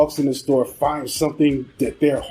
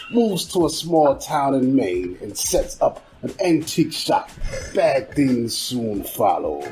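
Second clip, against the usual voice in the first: creepy narrator; 3 semitones lower than usual. Acted. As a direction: read it low and ominous, stretching the line endings. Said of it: "working on my creepy narrator voice".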